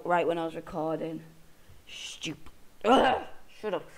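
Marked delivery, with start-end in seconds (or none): 1.9-2.5 breathy whispered jab at the hiccups; annoyed whisper aside; whispered insult. Real, not acted. Muttering at the hiccups. 2.8-3.8 annoyed snap. Real, not acted. Snapping at the hiccups.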